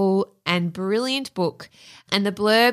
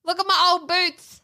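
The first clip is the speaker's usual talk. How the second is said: high goofy goose voice; silly clown; 9 semitones higher than usual. Pretend. Playing a goose with boots.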